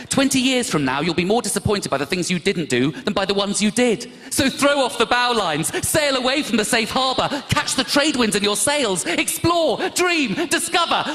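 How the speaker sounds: loud, high, building to fervent peaks; mock inspirational. Comic mocking a Facebook quote, mock inspirational.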